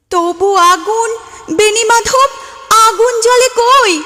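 high, loud dramatic cry of anguish; impassioned outcry. Acted. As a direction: cry out to the absent lover. Climax of recited love poem.

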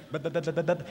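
mimicked embarrassed spluttering; flustered stammer. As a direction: stammer like someone caught out, a little low.

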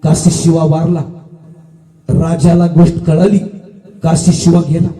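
hushed-down solemn storytelling pitch; somber death announcement. A narrator's reading. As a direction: drop low and let the death land, with long pauses. Narrator reports the hero's death.

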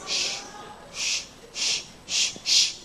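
hushed hissing puffs of air freshener; imitated spray noise. Wife spraying air freshener at the visitor, imitated hissing. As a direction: imitate short bursts from a spray can.

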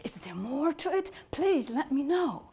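high, eager little angel; excited. Acted. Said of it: "the little angel got excited".

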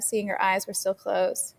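plain reading voice

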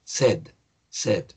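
"said" with a short e, like Spanish "sed"; pronunciation of said. Shown as a demonstration. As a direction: say "said" as one short syllable, "sed". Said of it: "La pronunciación es como si".

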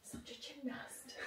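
unvoiced, slow self-mocking whisper. Real, not acted. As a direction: whisper it to yourself. Self-mocking aside after fail.

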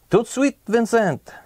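a hint of a French Monsieur; French accent. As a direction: greet him with a bit of a French accent. Monsieur Bags greets, French.

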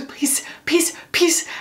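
put-on breathy, half-whispered little-kid "peace" for please; imitating her toddler. Mom imitating her son begging please as peace. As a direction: plead "peace, peace" like a tiny boy. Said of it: "he just goes".

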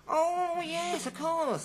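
high-pitched, drawn-out dawning comprehension; mock realisation. Customer grasping the clerk's translation, mock realisation. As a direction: let the penny drop theatrically.

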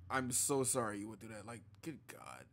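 soft, low, heartfelt apology; sincere sympathy. Real, not acted. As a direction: console gently in a hushed voice. Consoling Bree after her story.

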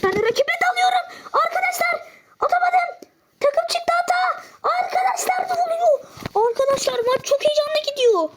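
frantic laughing panic over the miss; excited shouting. Real, not acted. Missed goal, chaotic game moment. (gülerek, çok yüksek sesle heyecanlı bağırış)

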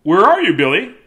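loud high call. Acted. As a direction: call across the room. Calling out.

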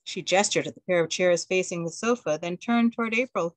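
ordinary delivery

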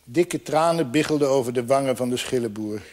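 usual speaking voice

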